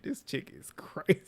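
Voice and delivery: helpless laughter squeezing out the words; barely voiced, talk through the laugh; real breathless laughing aside. Real, not acted. Cracking up at the retold comment.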